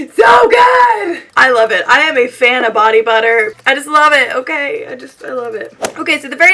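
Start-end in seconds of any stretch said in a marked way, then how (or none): 0.1-1.2 high, loud burst of praise; shouted rave; gushing shout. Real, not acted. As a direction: belt it out high with delight. Bursts out praising body butter.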